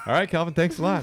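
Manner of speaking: high, loud interviewer sign-off; chirpy imitation of a TV interviewer; upbeat mock interviewer. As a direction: wrap up an interview brightly over a laugh. Voicing Calvin's interviewer.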